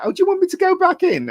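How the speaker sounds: high, sing-song mock girly voice; mocking Harley. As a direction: ham up a cheerful offer. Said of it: "She comes out".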